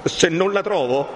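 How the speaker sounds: high, urgent rush of resolve; distraught young man. A narrator's reading. Renzo vows to search the whole lazzaretto, distraught. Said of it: "cambiandosi tutto in viso".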